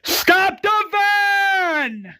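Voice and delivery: mock scream held long on VAN. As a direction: stretch every word into a long, high cry. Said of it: "Daniel screams".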